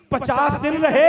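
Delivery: very loud, high-pitched proclamation; thundered reveal. Real, not acted. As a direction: hammer the number home to the crowd. Same reveal repeated at full force. (गरजती, ऊँची आवाज़ में ऐलान)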